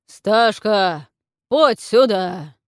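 booming slow summons; зычный зов. A narrator's reading. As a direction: call her loudly across the house. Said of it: "зычно позвал Тудор". (зычно позвал)

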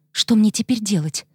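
ordinary delivery

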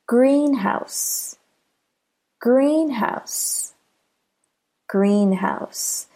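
teacher's model of "greenhouse", emphasis on "green"; stress on GREEN. Shown as a demonstration. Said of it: "Do you hear how green is stressed?".

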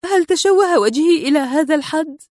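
rising pitch as worry takes hold; worried question. A narrator's reading. Woman fearing for her injured face, anxious murmured question. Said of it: "مغمغمة".

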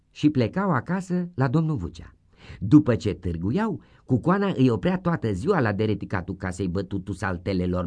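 ordinary delivery, nothing marked

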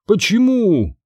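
put-on high-pitched girl's outcry; startled high question; indignant cry. A narrator's reading. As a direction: demand a reason, voice jumping up. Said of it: "крикнула Сабрина".

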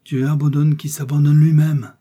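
high, loud, ringing maxim; milady stoking Felton's zeal; solemn pronouncement. A narrator's reading. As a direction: deliver it like a verdict.

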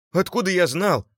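high, loud, defensive squeal; young man's shrill protest. A narrator's reading. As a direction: squeal your excuse in protest. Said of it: "взвизгнул молодой человек".

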